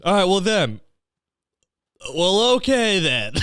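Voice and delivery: high, slow deadpan mock acceptance; hammed-up high dismissive reply. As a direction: shrug off the ultimatum.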